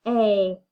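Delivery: A-k as two separate sounds; a, then k; tapping out sounds. Shown as a demonstration. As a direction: split ache into its two sounds. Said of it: "Tap ache".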